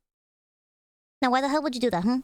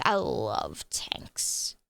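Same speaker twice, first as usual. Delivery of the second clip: breathy whispered confession, low; 12 semitones lower, 6 dB quieter, much slower than usual. Pretend. Whispering his love of tanks to friends. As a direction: hush it like a secret.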